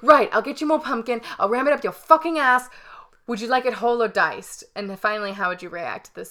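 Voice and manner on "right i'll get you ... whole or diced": loud, higher mocking chef threat; mock furious chef threatening a cook; angry chef rant. Redoes Gordon's pumpkin threat louder.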